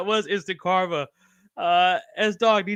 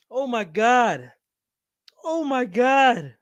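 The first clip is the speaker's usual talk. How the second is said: shocked laughter; 4 semitones higher, much slower than usual. Real, not acted. Shocked after the choke.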